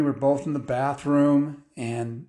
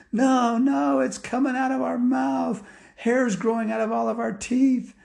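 put-on high, pleading kid voice; distressed children, pitched way up; frantic boys; 11 semitones higher than usual. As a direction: insist in acted panic. Boys frantically insist to dad.

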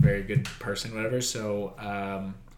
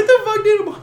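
much higher, louder disbelieving yelp; shocked outburst; 13 dB louder than usual. Retold or imitated. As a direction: squeal it in total disbelief. Reacting to competitive moms.

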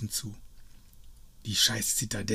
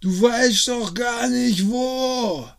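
sehr hoch und laut gerufen; verzweifelter Ruf; 12 semitones higher, 6 dB louder, much slower than usual. Acted. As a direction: ihr nachschreien. Ruft ihr weiter nach. (sehr hoch gerufen)

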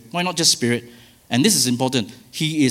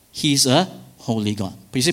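ordinary talk

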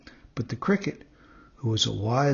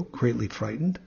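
usual speaking voice

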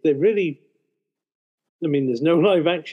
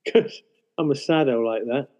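normal talking voice

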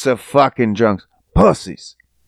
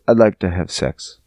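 much lower, quiet deadpan line; low voiced girl; 11 semitones lower, 4 dB quieter than usual. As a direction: say it low and flat.

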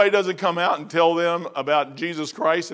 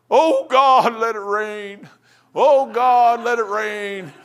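mock fervent prayer; 2 semitones higher, much slower than usual. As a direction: imitate a pleading prayer, swelling then easing. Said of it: "I'm praying".

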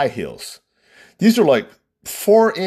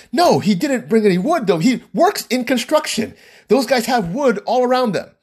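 indignant bride; 10 semitones higher than usual. Retold or imitated. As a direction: imitate a bride arguing back, pitched up. Bride voiced, arguing back indignantly.

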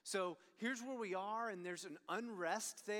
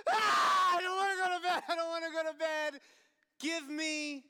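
put-on crying toddler tantrum; 8 semitones higher, 10 dB louder than usual.

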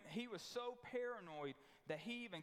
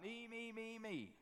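mocking me me me; 4 semitones higher than usual. Mocking Herod's selfishness.

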